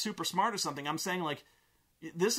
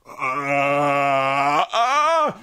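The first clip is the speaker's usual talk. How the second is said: imitated scream; 3 semitones lower, 11 dB louder than usual. Imitating a ghost kid from the box, imitated scream.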